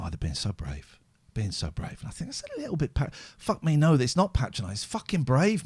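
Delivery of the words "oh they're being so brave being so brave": mock pitying coo. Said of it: "people go".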